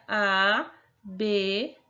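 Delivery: "abelha" spaced out as a... be... lha; slow three-beat demo of "abelha"; slow syllable-by-syllable. Shown as a demonstration. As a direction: sound out "abelha" with a pause after each syllable.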